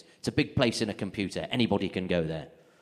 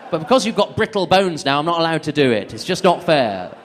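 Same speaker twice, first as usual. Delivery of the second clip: exasperated; 3 semitones higher, 7 dB louder than usual. Acted. Ranting at mum.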